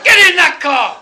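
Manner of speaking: very high, full-volume bellow; exasperated officer's shout; shouted police order. Retold or imitated. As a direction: yell it, out of patience. Acted police order repeated.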